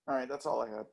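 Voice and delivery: ordinary talk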